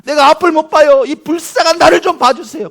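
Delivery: high, loud, urgent plea; shouted desperate prayer; Bartimaeus's pleading prayer. Acted. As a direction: beg the Lord at full voice. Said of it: "그렇게 기도했어요".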